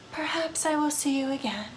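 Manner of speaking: slow, soft, mournful vampire voice; a lonely undead woman drifting through her sorrows; brooding vampire ghost. Acted. Vampire ghost confiding in a lonely spirit, slow and hushed. Vampire character in dating game.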